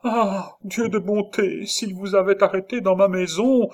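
high, loud fright; appalled exclamation. A narrator's reading. Nurse imagining his arrest at hers, appalled high cry. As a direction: invoke God in alarm.

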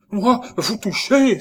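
high, loud, drawn-out recoil; horrified cry. A narrator's reading. Nurse recoiling from the dead man, horrified cry. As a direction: exclaim in horror at the very idea. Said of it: "s'écria-t-elle avec horreur".

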